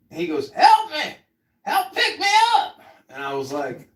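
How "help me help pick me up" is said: begging shout. Retold or imitated. Old man begging again.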